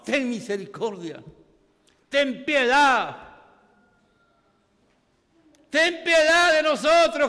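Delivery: loud, high, drawn-out cry for mercy; fervent preaching. Acted. Pastor voices the blind men's plea, loud and slow.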